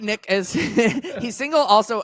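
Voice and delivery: high, laughing hype-up of a single friend; laughing tease. Real, not acted. Teasing announcement about single Nick.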